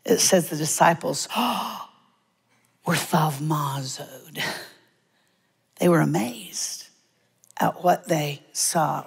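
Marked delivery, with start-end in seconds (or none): 1.3-1.8 gasp. Acted. Acting disciples' amazement. 2.8-4.4 hushed, slow breathy awe; awed whisper. Acted. Preacher showing disciples' amazement, whispered.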